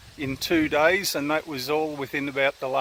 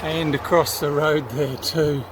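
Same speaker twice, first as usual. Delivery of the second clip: ordinary delivery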